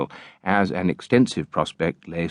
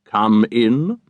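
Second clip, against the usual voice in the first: virtuously, not severely; 3 semitones higher, 5 dB louder than usual. A narrator's reading. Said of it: "not severely, only virtuously".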